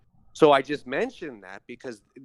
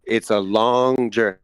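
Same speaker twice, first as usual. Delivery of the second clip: "long" stretched out loud; stress on long; 11 dB louder than usual. Real, not acted. Agreeing with the guest about the journey, "long" drawn out loudly. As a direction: lean hard and slowly on "long", much louder. Emphatic echo of guest's phrase.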